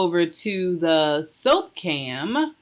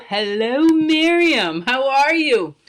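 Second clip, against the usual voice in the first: excited greeting; 7 semitones higher, 8 dB louder, slower than usual. Real, not acted. Greeting a viewer live.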